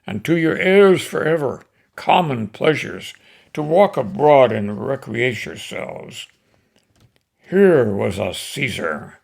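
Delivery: declaiming Shakespeare, swelling to loud peaks; dramatic recitation. Acted. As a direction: deliver Antony's speech to the crowd with rising passion. Antony addresses the crowd.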